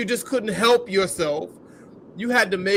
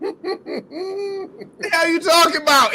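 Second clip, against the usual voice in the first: laughing disbelief; 8 semitones higher, slower than usual. Real, not acted. Laughing at her excuse.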